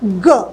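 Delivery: G sounded as ग; letter sound. Shown as a demonstration. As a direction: drop low and sound out ग. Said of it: "जी".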